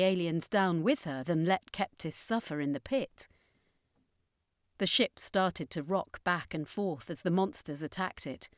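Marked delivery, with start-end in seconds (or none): none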